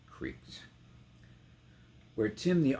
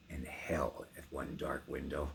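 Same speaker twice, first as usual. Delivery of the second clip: whispered, hushed and grim; dread whisper; 5 semitones lower, 6 dB quieter than usual. A narrator's reading. Narrator telling of hell at the window, whispered. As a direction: breathe it like a secret of doom.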